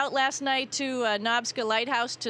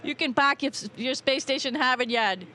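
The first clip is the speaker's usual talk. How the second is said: Boston accent; 3 semitones higher, 3 dB louder than usual. Shown as a demonstration. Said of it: "Let me hear your Boston accent".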